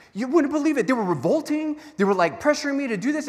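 high, loud, hurried pleading; flustered excuse. Acted. Aaron excusing himself to Moses, high panicked pleading. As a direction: squeak out excuses like a caught kid.

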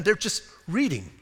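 ordinary talk, nothing marked

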